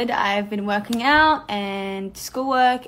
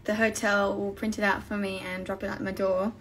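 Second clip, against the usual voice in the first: ordinary talk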